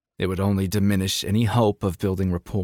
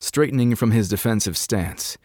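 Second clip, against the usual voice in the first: ordinary delivery, nothing marked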